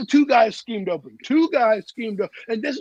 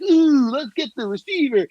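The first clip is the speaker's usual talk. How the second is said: mocking eager fans; 5 semitones higher, 3 dB louder than usual. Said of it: "you wanted the sexy pick".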